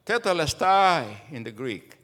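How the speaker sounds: ordinary delivery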